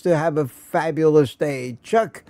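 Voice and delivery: ordinary talk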